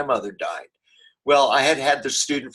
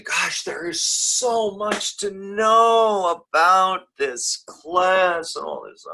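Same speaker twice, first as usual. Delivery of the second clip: slow, higher mock whine; whining student; 7 semitones higher, much slower than usual. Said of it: "I hear this whining".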